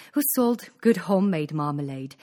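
plain reading voice

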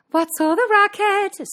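high, loud shrieked question; cry. A narrator's reading. Cindy hears the commotion, high loud cry. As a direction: call it out high over the noise. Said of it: "Cindy cried".

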